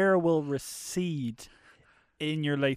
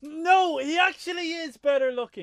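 much higher, mock-grudging admission; imitating his co-host; 12 semitones higher, 4 dB louder than usual. Co-host forced to admit at gunpoint, high mock-grudging reply. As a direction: squeak out a forced confession. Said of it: "you go".

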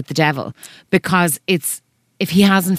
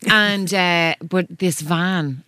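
usual speaking voice

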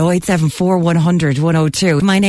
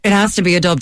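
usual speaking voice

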